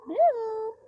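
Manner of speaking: high, loud, singsong call; calling a pet. Real, not acted. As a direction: call the hen from across the yard. Calling a chicken.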